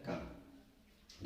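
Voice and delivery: a slow, breathy whisper of the answer. Shown as a demonstration. Teacher whispers the answer to the class, slow whisper. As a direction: breathe the word out as a hint.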